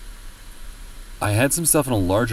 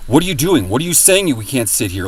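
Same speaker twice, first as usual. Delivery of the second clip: raised, indignant retold shout; mock angry yell; 5 semitones higher, 7 dB louder than usual. Old couple yelling at the speaker, mock furious shout. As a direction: replay the couple snapping at you. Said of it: "they literally started screaming at me".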